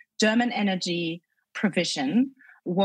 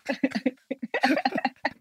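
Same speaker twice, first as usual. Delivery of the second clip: amused laugh; 6 semitones higher than usual. Real, not acted. Laughing at pronunciation.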